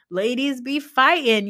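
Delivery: sarcastic quoting of a tired cliché; mock sassy catchphrase. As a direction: send up the line with a slow, sing-song sneer. Mocking a catchphrase they repeat.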